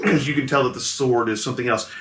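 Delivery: normal talking voice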